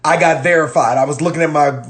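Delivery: ordinary talk, nothing marked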